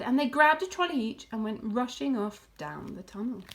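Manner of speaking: usual speaking voice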